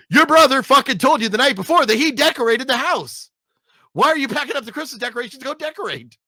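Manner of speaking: loud, high-pitched incredulous outburst; worked-up scolding, racing by the end; exasperated rant. Pretend. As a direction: yell at the movie character for being so dense. Ranting at the film character's stupidity.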